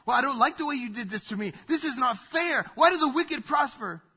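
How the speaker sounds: aggrieved worshipper protesting to God; indignant complaint. Retold or imitated. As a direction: complain as if it's unfair. Voicing an angry complaint to God.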